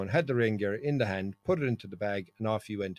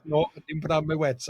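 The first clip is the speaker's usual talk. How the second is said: higher, louder mock friend; sheepish admission; 6 semitones higher, 5 dB louder than usual. Soaked friend admitting no wets, higher. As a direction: admit it in a raised voice.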